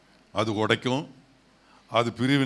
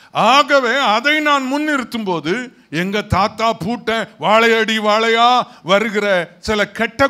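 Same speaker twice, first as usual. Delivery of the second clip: high, loud, impassioned sermon; raised pulpit voice, declaring with conviction; full-force preaching; 8 semitones higher, 6 dB louder than usual. Real, not acted. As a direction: drive it home to the whole congregation.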